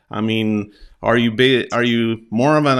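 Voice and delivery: ordinary delivery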